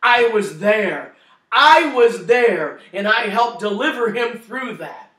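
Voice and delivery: lightly voiced big angel, proclaiming; booming angel. Husband imagines the angel telling his wife, slow and loud.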